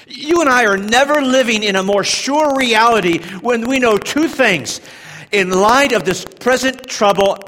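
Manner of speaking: rising, loud preacher's cry; preaching at full force. Acted. Preacher driving home the Lord's helpfulness, loud and high. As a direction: build the sermon to a roar.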